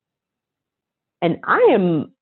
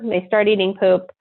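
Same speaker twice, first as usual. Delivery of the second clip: ordinary talk, nothing marked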